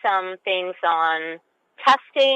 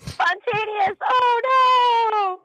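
laughing shriek; 13 semitones higher, much slower than usual. Real, not acted.

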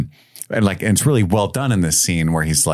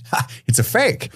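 imitated happy discovery; 9 semitones higher than usual. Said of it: "you can see how happy Indy gets".

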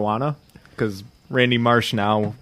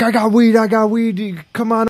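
higher, louder eager sales call; excited dealer pitch; 10 semitones higher, 6 dB louder than usual. Retold or imitated. Token's dad greeting customers, loud and eager. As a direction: push the weed on them, hyped. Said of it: "he's like".

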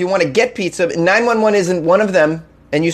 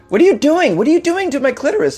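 much higher, fast, alarmed woman's voice; startled woman; 10 semitones higher than usual. Pretend. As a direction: play a shocked woman squealing.

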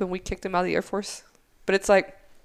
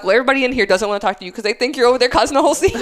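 fast, high, loud retold reproach; exasperated scolding; 5 semitones higher, 6 dB louder, faster than usual. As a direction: rattle off the complaint at the supervisor. Acted scolding of supervisor.

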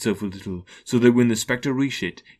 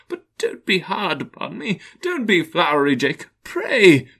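pleading Scrooge; 9 semitones higher, 4 dB louder than usual. Acted.

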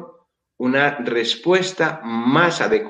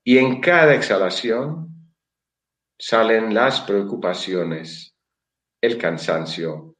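guided meditation. Acted. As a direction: lull them into calm, slowly.

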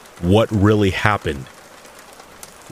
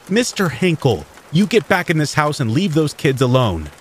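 stern nurse; 7 semitones higher than usual. A narrator's reading. Nurse scolds old man.

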